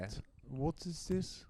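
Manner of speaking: soft, put-on European English accent; imitated European accent. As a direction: mimic a European trying English. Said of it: "That's how they talk".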